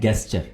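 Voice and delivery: gesture with a hard g sound, like get; the common mistake, gesture with a hard g; wrong pronunciation. Shown as a demonstration. Teacher demonstrating the mistake, gesture with a hard g. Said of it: "आमतौर पर दोस्त इसको गलत pronounce करते हैं".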